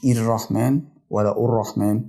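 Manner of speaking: "الرحمن" as ir-rahman, then ur-rahman; wrong opening vowels. Shown as a demonstration. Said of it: "مش".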